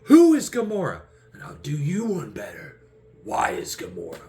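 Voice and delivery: high and loud, then dropping back; mock one-upping. Star-Lord one-upping the question, high then sinking. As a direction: escalate each line, then deadpan. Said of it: "he's like".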